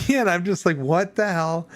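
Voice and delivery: laughing disbelief. Real, not acted. Laughing, mocking the video.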